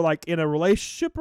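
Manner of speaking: ordinary delivery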